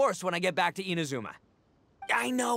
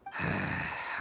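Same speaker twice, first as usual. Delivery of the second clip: long deflated sigh; resigned sigh at having to go; heavy sigh; 6 dB quieter than usual. Acted. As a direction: let all the air out in defeat. Boss forced to leave now.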